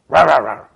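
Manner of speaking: loud, imitated dog-like bark; short burst of noisy barking at someone; mock bark. Husband home from work barks at his wife, mock barking. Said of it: "I just bark at her".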